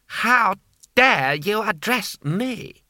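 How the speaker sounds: slow, high, outraged noble; affronted Lord Hong. A narrator's reading. Lord Hong to the grimy stranger, slow outraged disbelief. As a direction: draw it out in disbelief.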